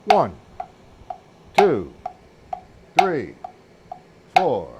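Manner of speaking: loud single numbers with wide even gaps; counting the beats. Shown as a demonstration. Drum teacher counting the downstrokes while playing, loud spaced counting. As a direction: call each downbeat crisply in time.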